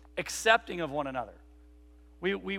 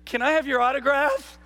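put-on higher, louder little-boy ask; eager kid; 7 semitones higher, 6 dB louder than usual. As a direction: ask like a star-struck kid. Kid runs up for autograph.

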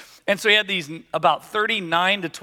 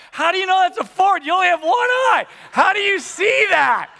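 much higher, louder disbelieving shout; incredulous shout; 12 semitones higher, 4 dB louder than usual. Retold or imitated. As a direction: yell in disbelief at your one-eyed dad. Son shouting in disbelief at dad.

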